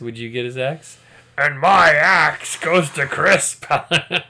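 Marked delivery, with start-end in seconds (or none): 1.4-4.2 loud, slow mock-heroic bellow; Gimli. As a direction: proclaim it like a fantasy warrior's battle cry. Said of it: "That's Gimli".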